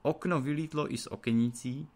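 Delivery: plain reading voice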